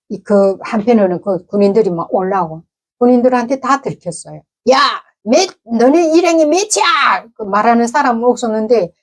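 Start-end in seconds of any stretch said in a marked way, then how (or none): 4.7-7.3 soldier's demand. Retold or imitated. Soldier interrogating the captured group.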